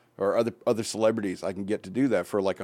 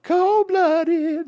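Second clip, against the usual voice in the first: high, squealed, hammed-up shock; mock shocked squeal; 4 dB louder than usual. Real, not acted. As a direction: squeal it in fake outrage. Reacting to harsh breakup.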